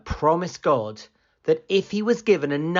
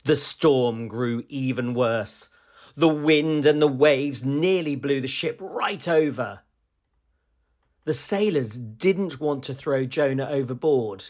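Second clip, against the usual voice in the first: usual speaking voice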